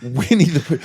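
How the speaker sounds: ordinary talk, nothing marked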